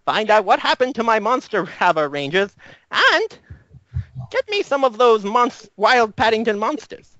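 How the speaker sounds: brief the team like a scatterbrained inventor; professor's send-off. Acted.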